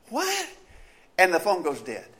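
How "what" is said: high sudden "What?"; hearing the news, stunned; shocked what. Acted. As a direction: gasp out the word in disbelief. Shocked reaction.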